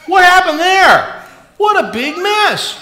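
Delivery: high, loud and slow playful dismay; mock surprise. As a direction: ham up the shock at the spill. Water spills over the bowl.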